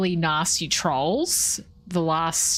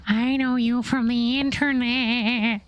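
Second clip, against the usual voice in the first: slow creepy goblin voice; 4 semitones higher, slower than usual. Acted. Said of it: "That's even worse".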